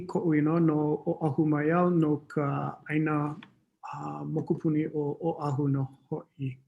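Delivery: usual speaking voice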